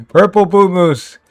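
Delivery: slow, loud mock hype; big drawn-out sarcastic billing. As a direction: announce it with sarcastic grandeur, word by word. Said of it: "I'm just being a little sarcastic".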